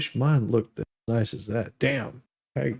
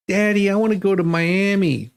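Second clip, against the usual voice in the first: spoiled daughter; 6 semitones higher than usual. Pretend. Joke punchline, daughter begging dad.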